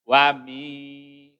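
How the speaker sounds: "wa mi" with the nasal held long; ikhfa. Shown as a demonstration. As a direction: hum through the end of "wa mi", slowly. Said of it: "ikhfa, ya".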